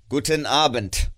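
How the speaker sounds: loud, curt dismissal; curt goodbye. Acted. Scrooge dismisses his nephew, curt and cold. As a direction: cut him off coldly.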